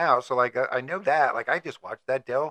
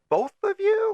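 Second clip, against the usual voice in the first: squeaky, much higher "both of you?"; mock innocent question; slower than usual. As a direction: play it as a coy, sky-high question. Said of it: "I'd be like".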